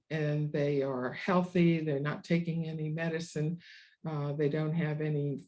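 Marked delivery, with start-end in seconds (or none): none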